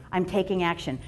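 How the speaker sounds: ordinary talk, nothing marked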